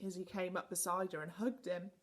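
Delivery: ordinary delivery, nothing marked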